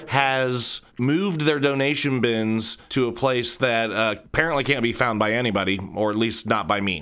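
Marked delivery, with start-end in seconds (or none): none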